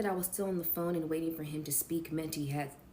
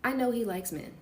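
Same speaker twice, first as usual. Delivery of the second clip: ordinary delivery, nothing marked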